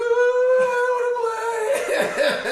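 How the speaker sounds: put-on high, drawn-out child's wail; little boy. Small son refusing to play on stage, high slow wail. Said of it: "I look over at Lamar".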